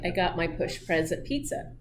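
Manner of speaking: normal talking voice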